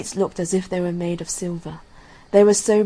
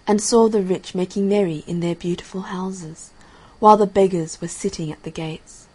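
ordinary delivery

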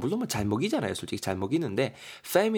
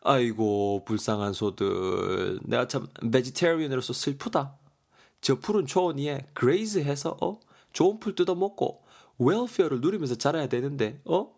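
hammed-up grief rising to a high wail; mock lament; 5 semitones higher than usual. Vegetarian Daegeon grieving for the cows, high mock wail. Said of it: "대건이가 말합니다".

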